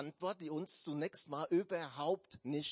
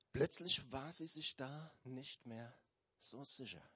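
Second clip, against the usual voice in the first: ordinary talk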